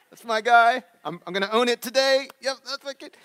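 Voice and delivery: proud dad. Retold or imitated. Dad bragging about his polite son, loud and proud. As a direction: crow it like a proud father.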